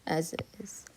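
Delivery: near-whisper under the breath; whispered aside. Real, not acted. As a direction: let it drop to a low whisper, as if to yourself. Muttered aside while writing the answer.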